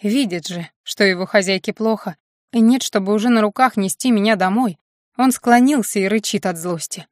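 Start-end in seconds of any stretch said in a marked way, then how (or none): none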